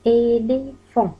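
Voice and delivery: "إليفون" drawn out syllable by syllable; careful demonstration. Shown as a demonstration. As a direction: say "إليفون" slowly so learners hear each sound. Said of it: "اسمعوا جيدًا من فضلكم".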